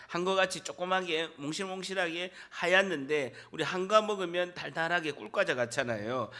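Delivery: ordinary talk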